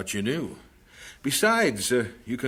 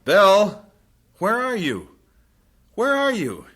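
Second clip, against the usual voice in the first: high loud calling; worried calling; 7 semitones higher, 7 dB louder than usual. Acted. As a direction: search the dark house for her. Calling for wife in dark.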